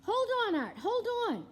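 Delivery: high, loud hold on; urging Arthur to hang on; urgent call. Real, not acted. Urgent calling to character.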